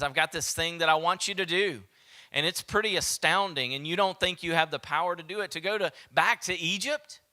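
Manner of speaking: incredulous pleading. Acted. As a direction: push it up, amazed at the ask.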